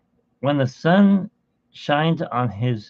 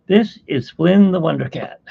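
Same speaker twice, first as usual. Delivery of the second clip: louder, higher showman intro; presenting the cat with a loud, higher showman flourish; grand introduction; 5 semitones higher, 6 dB louder than usual. Real, not acted. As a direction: present him like a star act.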